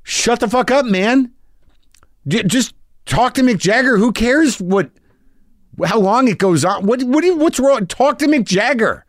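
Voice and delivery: mock-frustrated rant at a dumb friend; exasperated friend yelling. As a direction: yell at him to just take the call. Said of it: "Brendan's like".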